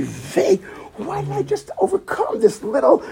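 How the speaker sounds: high-pitched retold wail; mock crying lament. Wicked man seeing it was only a hair, mock wail. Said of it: "he starts crying".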